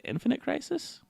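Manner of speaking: rising, unsure question; checking the name, high rise. Real, not acted. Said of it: "Yeah, Infinite Crisis".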